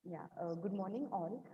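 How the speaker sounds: faint, far from the mic; distant, too quiet to hear; barely audible. Real, not acted. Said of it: "Your voice is very low".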